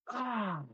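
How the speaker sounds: high, soft airy "ah"; deep breath. Retold or imitated. Man reliving the punch in the stomach, high breath. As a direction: reenact a sudden high breath, softly voiced. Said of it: "Take one more deep breath".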